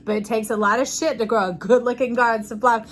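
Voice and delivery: ordinary talk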